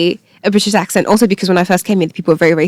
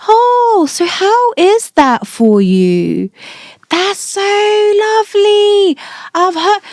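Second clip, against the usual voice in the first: put-on much higher and very slow, talking down as if to a child; patronising slow talk; 10 semitones higher, much slower than usual. Mocking how locals talk down to a Nigerian student, high and very slow. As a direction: drag every word out sweetly like speaking to a toddler. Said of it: "people start talking to you slower".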